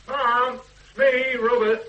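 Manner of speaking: loud, high call as you come in. Acted. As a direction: announce yourself across the house. Son calling on arrival.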